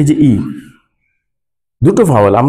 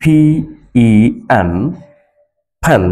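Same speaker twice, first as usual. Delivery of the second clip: letter by letter with long pauses; P, E, N spelled with pauses, then fan. Shown as a demonstration. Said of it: "লক্ষ্য করুন".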